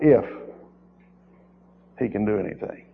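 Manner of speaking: mock doubtful if he can; quietly mimicking a doubter; doubtful mimic. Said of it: "that's a wrong approach with the Lord".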